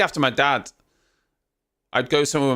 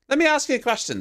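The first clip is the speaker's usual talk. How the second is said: high, mock-serious question; teasing; 13 semitones higher, faster than usual. Real, not acted. Teasing Tom about his missing Scottish accent, high mock-serious. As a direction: set up the tease.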